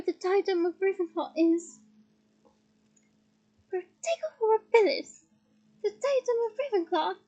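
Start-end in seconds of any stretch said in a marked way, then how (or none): 3.7-7.2 lightly voiced small high wizard; a touch of squeak, raised pitch; squeaky Flitwick. A narrator's reading. Excited Flitwick answers Harry, lightly squeaky. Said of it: "squeaked Flitwick".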